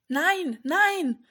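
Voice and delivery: two loud, high, falling cries; insistent call. A narrator's reading. Brothers contradicting their father, loud insistent cries. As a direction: call out in emphatic protest. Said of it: "riefen die Brüder".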